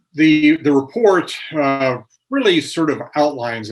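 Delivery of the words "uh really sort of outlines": outlines leaned on a little louder; emphasis on outlines. Real, not acted. Reporter to host, stressing outlines in the USDA report. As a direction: give outlines a deliberate push. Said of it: "and I emphasize the word outlines".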